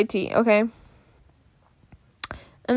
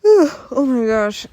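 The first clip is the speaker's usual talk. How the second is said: yawning; 3 semitones higher, 5 dB louder, slower than usual. Real, not acted. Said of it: "I'm so tired".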